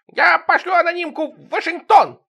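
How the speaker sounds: very high, loud yelling; shrill yell. Acted. Sidorych yelling at the neighbors, very high. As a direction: scream the threat.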